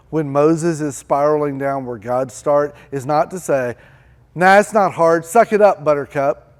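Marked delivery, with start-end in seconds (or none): 4.3-6.5 louder, higher, dismissive; mock tough love. Mock coach telling Moses to suck it up, loud and dismissive. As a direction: brush him off like a gruff coach. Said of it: "He didn't say that".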